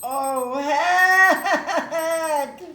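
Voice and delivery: laughing shout. Real, not acted. Cracker challenge ends, laughing.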